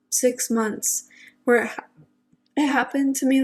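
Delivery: ordinary talk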